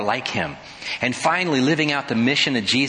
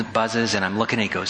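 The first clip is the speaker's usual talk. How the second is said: normal talking voice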